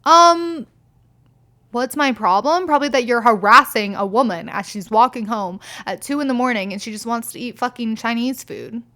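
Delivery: loud, sassy comeback; sassy retort. Retold or imitated. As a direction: tell them off with attitude. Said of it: "I'm like".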